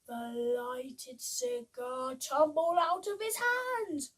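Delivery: put-on lively read-aloud for children; bouncy rhyming picture-book voice; storybook reading. A narrator's reading.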